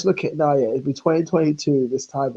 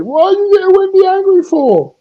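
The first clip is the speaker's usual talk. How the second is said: much higher, louder whiny mimic; mocking Rowan; 7 dB louder than usual. Mocking Rowan's phone call, high whiny mimic. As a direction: squeal the question like a whiner. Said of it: "he actually phoned me and said".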